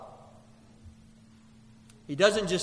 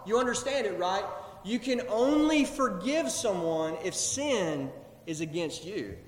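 ordinary delivery

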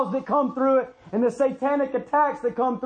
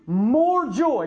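"more" drawn out slowly before "joy"; slow, weighty emphasis on "more"; stress on more. Real, not acted. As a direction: lean long and hard on the word "more". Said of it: "not joy, more joy".

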